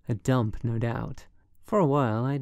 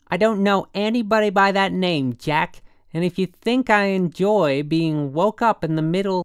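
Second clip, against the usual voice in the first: high, loud, indignant woman; near yelling, angry; 8 semitones higher, 8 dB louder than usual. A narrator's reading. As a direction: tear into the caller like a tigress. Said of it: "She was damn near yelling".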